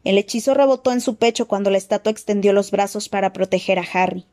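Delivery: usual speaking voice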